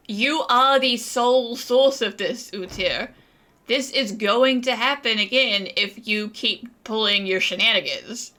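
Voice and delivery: ordinary talk, nothing marked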